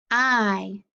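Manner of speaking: "I" said alone as a long eye sound; isolated vowel sound. Shown as a demonstration. Teacher modelling the igh sound for children. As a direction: give just the "I" sound.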